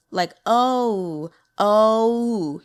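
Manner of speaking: long "oh" gliding into an oo at the end; English diphthong O. Shown as a demonstration. Teacher exaggerating English O, long diphthong "oh". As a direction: draw out "oh" and round it closed. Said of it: "when we say O".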